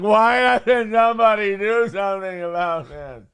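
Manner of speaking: mock a helpless whiner; whiny activist voice. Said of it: "statue going".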